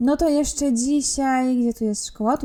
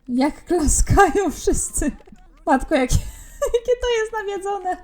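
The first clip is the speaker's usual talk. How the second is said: laughing; 9 semitones higher than usual. Real, not acted. Laughing at chaotic Sims wedding.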